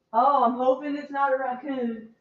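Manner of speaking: normal talking voice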